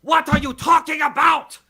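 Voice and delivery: high, loud indignant shout; mock angry. Imitating Tommy getting mad at a fan, mock outraged shout. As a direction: explode like an offended bad actor. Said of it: "he gets mad and he goes".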